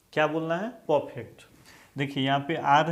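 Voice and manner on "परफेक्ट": परफेक्ट as "paw-fekt", R left out; silent R. Shown as a demonstration. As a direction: drop the R in परफेक्ट. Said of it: "आर को आपको नहीं पढ़ना है". (परफेक्ट को "पॉफेक्ट" बोलें, आर चुप)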